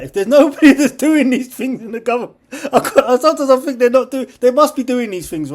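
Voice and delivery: high, loud laughing rant; laughing disbelief. Real, not acted. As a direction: laugh through the words in disbelief. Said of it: "I'm completely crazy".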